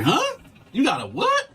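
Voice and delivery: squeaky high startled huh with a laugh; sudden high-pitched disbelief; shocked disbelief. Retold or imitated. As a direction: act floored by the news. Guard says she'll write him up.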